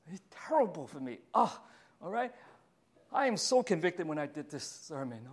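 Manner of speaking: ordinary talk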